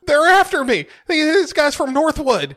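very high, hammed-up hysteria; panicked pleading. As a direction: babble like a terrified fugitive. Playing panicked Gary pleading for shelter.

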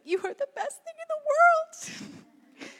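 put-on very high-pitched cooing to a baby; gushing mother. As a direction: gush over your baby in a high, silly squeal. Mother gushing over her baby.

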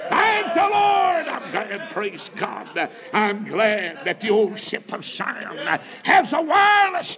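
high, stretched-out revival holler; full-force preacher's exultation; shouted praise. Real, not acted. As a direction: shout praise to heaven, drawing out the words.

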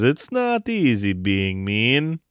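slow, sinister Russian villain voice; mock-evil Russian frog impression; evil, ominous Constantine. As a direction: draw each word out with a hammy Russian accent. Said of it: "but in a really evil, ominous way".

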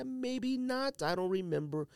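ordinary talk

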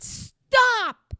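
loud, high yell of stop; sharp shout. Retold or imitated. Ordering her partner to stop the car, sudden shout. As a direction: bark it to halt the car. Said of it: "Just like that".

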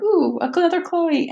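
high-pitched, pleased little ooh; retold giddy discovery; delighted. Retells delight at a familiar name.

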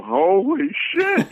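high, slow, awestruck; starstruck. Retold or imitated. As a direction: drag it out, stunned to meet him. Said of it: "the person would go".

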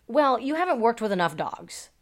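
ordinary delivery

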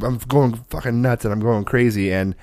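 ordinary delivery

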